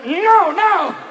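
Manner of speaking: high, loud acted denial; mock protest. Politician excusing himself over a page, high mock protest.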